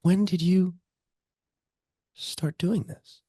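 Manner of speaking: put-on mother marvels at her toddler's new skills; amazed parent. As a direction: wonder at how fast the baby grew. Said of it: "It's like".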